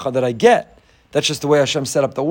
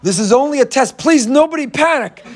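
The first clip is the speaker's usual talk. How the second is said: high, urgent public announcement; loudspeaker announcer; 10 semitones higher, 4 dB louder than usual. Retold or imitated. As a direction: call out a panicky terminal announcement. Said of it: "this guy gets on the loudspeaker".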